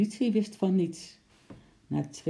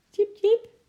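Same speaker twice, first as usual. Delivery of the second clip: very high, loud chirp; squeaking little animal; 8 dB louder than usual. Acted. Little Eki to Sjimp, eager to join in, squeaking. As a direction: squeak like a tiny squirrel.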